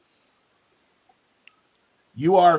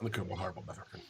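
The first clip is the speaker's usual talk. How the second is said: muttered aside; 10 semitones lower, 20 dB quieter, much faster than usual. Real, not acted.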